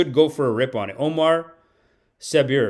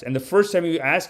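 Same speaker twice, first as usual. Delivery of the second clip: usual speaking voice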